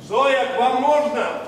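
loud high call, a bit slow. Acted. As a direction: call through the door to be heard. Calling to Zoya through door.

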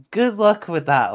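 high, loud story-book jeer; mocking amusement. A narrator's reading. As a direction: scoff loudly at the plan. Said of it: "laughed the cat".

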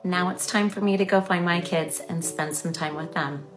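plain reading voice

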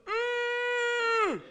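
loud high drawn-out "mmm" groan; truck straining noise. Retold or imitated. Storyteller mimics his overloaded truck, loud high strained hum. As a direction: imitate a truck squashed by a heavy load. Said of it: "and my truck went".

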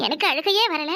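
normal talking voice

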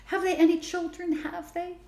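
high pleading ask; beseeching. A narrator's reading. Girl beseeches her father about the new neighbors. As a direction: beg for the answer, voice lifted. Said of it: "Belinda beseeched".

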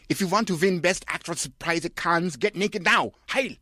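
high, strange tyrant bark; shouting director. Acted. Director bribing Kirsten with a prize, high bark. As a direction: rant like a crazed dictator. Von Trier voiced shouting.

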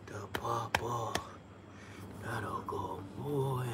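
slow, soft praise for a dog. Real, not acted. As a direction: gently coo over the puppy.

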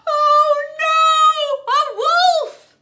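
high, loud storybook dismay; mock alarm. A narrator's reading. Storyteller finds a wolf in the egg, loud mock alarm. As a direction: cry it out in dramatic alarm.

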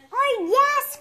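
high, loud eager cry. A narrator's reading. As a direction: cry it out with joy, voice leaping up. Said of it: "cries Nabu".

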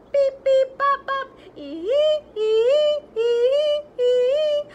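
high, loud beeping squeals; robot beeps. Acted. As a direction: beep like a silly robot. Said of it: "makes silly sounds like".

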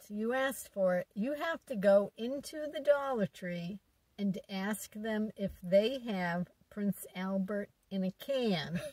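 normal talking voice

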